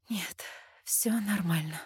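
soft slow exhale, barely voiced; breathed whisper. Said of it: "выдохнула я".

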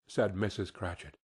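plain reading voice

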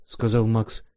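usual speaking voice

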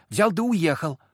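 put-on high, loudish child's voice; girl butting in. A narrator's reading. Girl butts in about the coachman, high and eager. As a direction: cut in like an eager little girl. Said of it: "влезла девочка".

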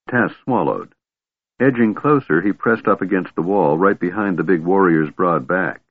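ordinary delivery, nothing marked

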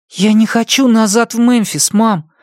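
put-on high, timid child's question; a small boy asking his mom; young boy voice. A narrator's reading. (робкий мальчик, высокий голос)

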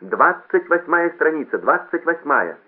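slow, high, loud page call; stretched public-address announcement; loudspeaker announcer. A narrator's reading. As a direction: draw the number out for the whole hall. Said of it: "сказал громкоговоритель".

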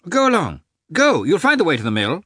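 high, loud urging call; cried out. A narrator's reading. Lievin urges Veslovsky on to the peasants, loud high call. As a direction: push him off toward the peasants. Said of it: "cried Lievin".

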